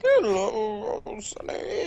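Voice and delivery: put-on high, creaky old man greeting; quavery grandpa voice, then a breathy whisper. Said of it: "I made an old grandpa voice".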